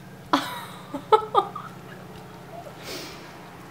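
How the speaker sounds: high airy giggle; breathy laugh. Real, not acted. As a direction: giggle through the gum. Tongue shown, laughs.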